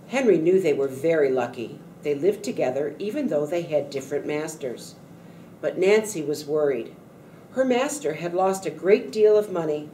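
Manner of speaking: usual speaking voice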